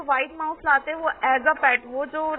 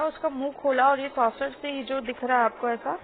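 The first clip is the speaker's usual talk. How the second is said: normal talking voice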